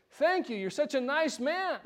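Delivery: high-pitched gushing thanks, lightly acted; imitating a grateful, tearful woman; mock tearful gratitude. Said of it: "she starts sobbing".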